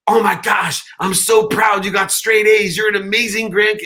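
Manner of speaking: put-on high, loud old New York grandma praising at a yell. Step grandma yelling praise at her grandchild, loud high voice. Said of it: "she had to yell at you for everything".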